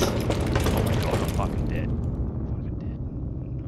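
breathy whisper of defeat; hushed, beaten whisper; whispered despair. Real, not acted. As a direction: whisper it like you're done for. Dying in game, muttered.

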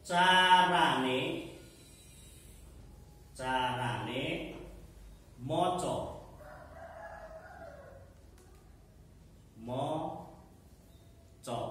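slow dictation with long pauses; dictating. Shown as a demonstration. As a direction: dictate word by word for note-taking. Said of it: "kok iso ditulis".

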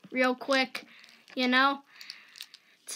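normal talking voice

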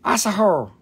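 playful jab. Acted. Repeats the insult playfully.

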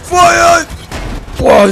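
loud startled cursing; shouting. Real, not acted. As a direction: yell it out in alarm. Firing in the chase.

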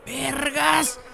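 loud, high excited shout; grito de asombro. Real, not acted. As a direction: holler thanks in amazement. Sudden joy at game's mods. (grito emocionado y agudo)

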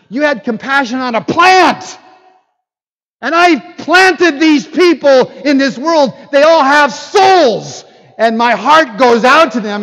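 loud, high, preaching at full force; impassioned rebuke. Acted. Preacher voices God's impassioned rebuke.

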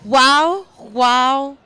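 loud, excited wow. Real, not acted. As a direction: gush it out loud. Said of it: "Gibt's auch eine Steigerung?".

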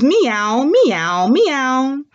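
three imitated meows in a row; sing-song teacher's kitty cries; cat meows. Shown as a demonstration. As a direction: meow three times like a cat. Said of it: "And they say what?".

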